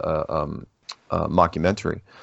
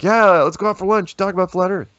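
put-on high, loud, eager kid voice; bright-eyed student asking to do lunch; 12 semitones higher, 5 dB louder than usual. Speaker mimics students eager to meet him, high and loud.

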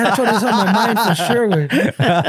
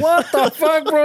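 mock disbelief, pitched up; a hint of shocked outrage at a friend's insult; 6 semitones higher than usual. Friend retelling a crude remark, mock shocked.

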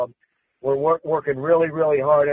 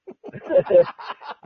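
high laugh. Real, not acted. Laughs at his own joke.

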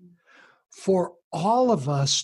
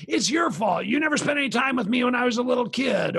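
higher, fast, shouted blame; kid's angry retort; 7 semitones higher, faster than usual. Retold or imitated. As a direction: hurl it back at the parent. Kid blaming parent back.

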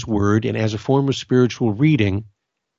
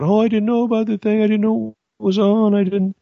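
high, whiny lament, pitched up; mock the moping of someone who missed out; 10 semitones higher than usual. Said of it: "people who are bummed out about".

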